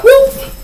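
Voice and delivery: high squealing whoop; a sharp high whoop for the car swerving; imitated tire-squeal whoop; whoop sound.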